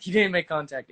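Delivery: normal talking voice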